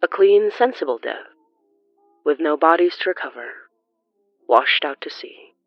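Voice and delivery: ordinary talk, nothing marked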